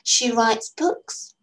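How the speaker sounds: put-on girl showing off what she knows, slow and lofty; air of authority. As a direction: lay it out like the expert. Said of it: "Letty said with an air of authority".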